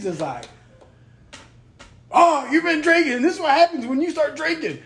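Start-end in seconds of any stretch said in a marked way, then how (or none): 2.1-4.8 high-pitched mock scolding; imitated angry girlfriend. Girlfriend blaming him for drinking, imitated anger. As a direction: mimic her telling you off, pitched way up. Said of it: "then she gets angry".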